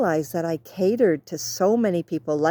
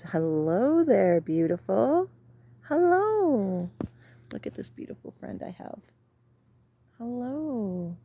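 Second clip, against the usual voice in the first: sweet talk to a bird; 4 semitones higher than usual. Real, not acted. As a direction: coo gently at a wild bird.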